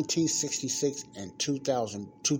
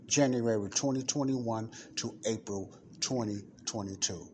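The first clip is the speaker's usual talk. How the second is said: usual speaking voice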